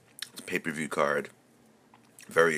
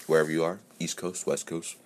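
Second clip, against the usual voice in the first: ordinary talk, nothing marked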